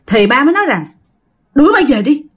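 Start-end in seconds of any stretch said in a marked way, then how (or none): 1.5-2.2 high, loud shouted order to throw her out; loud, fast, frantic shout; retold shouting. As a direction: yell it like a man gone strange. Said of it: "Mà ba làm kỳ lắm các bạn".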